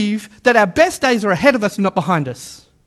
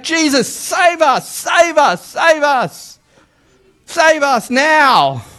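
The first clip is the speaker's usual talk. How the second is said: high pleading cries of save us; crowd crying out; 4 semitones higher, much slower than usual. Retold or imitated. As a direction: cry out like a desperate crowd.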